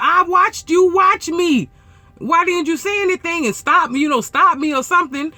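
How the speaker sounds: high, loud retold scolding; exasperated confrontation. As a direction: demand answers, voice climbing in indignation. Retold quarrel with the other driver.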